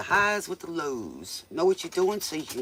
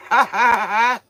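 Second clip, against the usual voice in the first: loud burst of laughter; 7 semitones higher, 14 dB louder than usual. Real, not acted. Big laugh at joke.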